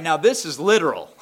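normal talking voice

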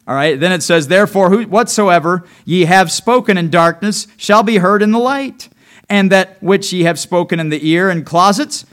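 loud, measured Bible reading; scripture reading. Acted. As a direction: proclaim the verses to the church. Reads scripture aloud.

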